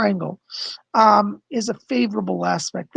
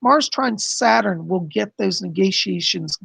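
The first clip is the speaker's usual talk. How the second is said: normal talking voice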